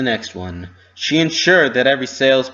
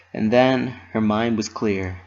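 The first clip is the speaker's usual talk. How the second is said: usual speaking voice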